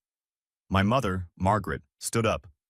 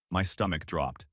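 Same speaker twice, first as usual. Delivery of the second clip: ordinary delivery, nothing marked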